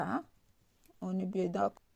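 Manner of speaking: normal talking voice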